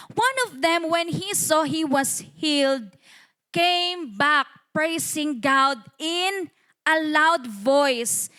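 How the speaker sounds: slow, spaced-out Bible reading; scripture reading. Acted. Preacher reading verse fourteen to the church, slow declamation. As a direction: read the verse out slowly, word by word.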